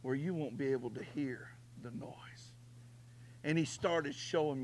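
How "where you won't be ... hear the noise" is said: God's gentle voice. Retold or imitated. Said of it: "He said".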